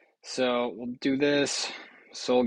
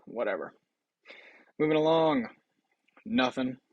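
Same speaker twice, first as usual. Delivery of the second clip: ordinary delivery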